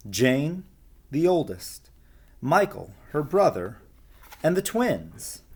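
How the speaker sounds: lively storybook reading; reading to children. A narrator's reading. Storyteller reading a picture book to children, lively. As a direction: read it like a bedtime story.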